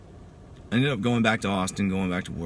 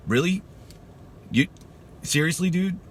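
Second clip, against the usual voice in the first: high-pitched, disbelieving questions; retold incredulous retort, voice jumping up; 10 semitones higher than usual. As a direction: throw it back in stunned disbelief.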